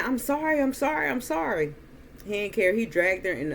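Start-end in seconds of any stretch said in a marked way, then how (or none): 0.0-1.7 retold pleading. Retold child pleading while being dragged.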